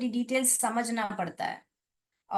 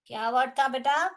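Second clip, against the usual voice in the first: ordinary delivery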